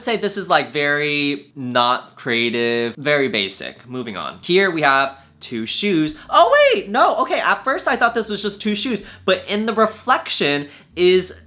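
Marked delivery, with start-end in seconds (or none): 6.3-8.7 sudden high, louder oh wait; surprised. Real, not acted. Realizing the shoe photo hides a kiss, surprised. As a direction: catch yourself noticing something.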